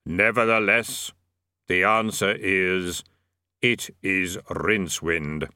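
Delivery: slow, deep, measured Death. A narrator's reading. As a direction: intone it slowly as Death. Death speaks.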